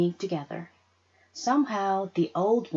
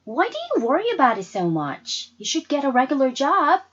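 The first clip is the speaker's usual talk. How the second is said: annoyed daughter; 7 semitones higher, 7 dB louder than usual. Acted. Daughter pushes back at Mama.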